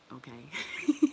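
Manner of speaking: bursting into laughter on okay; laughing. Real, not acted. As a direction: laugh it out, high and loud. Laughs about Shamika.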